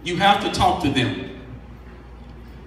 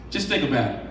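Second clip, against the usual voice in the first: dropped low and soft to a near hush; softly, letting the congregation reflect; quiet; 7 semitones lower, 6 dB quieter, faster than usual. Shown as a demonstration. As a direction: pull the volume right down, intimate. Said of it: "repeated softer".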